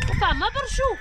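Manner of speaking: loud, high shouted reply; shouting. Real, not acted. Worker answering a companion, shouting. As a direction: shout back at full voice.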